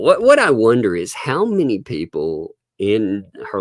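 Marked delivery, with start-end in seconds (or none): none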